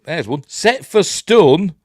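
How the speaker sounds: high, loud, drawn-out command; mock trooper shout; stormtrooper order. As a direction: call the order out to the squad. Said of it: "so he says".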